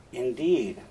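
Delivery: brief, falling, noncommittal remark; understated insinuation. Acted. Iago planting doubt in Othello about Cassio, understated insinuation. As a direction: let it drop casually to plant doubt.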